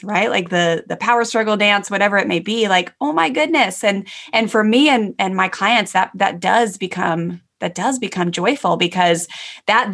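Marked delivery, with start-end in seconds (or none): 3.0-3.8 high, acted wonder; amazed realization. Coach voicing a client's aha moment, amazed and high. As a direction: exclaim it in a higher voice, as if just realizing.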